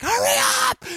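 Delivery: put-on very high, frantic shouting at the kids; panicked shout. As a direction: scream it across the park in a panic. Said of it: "I am like".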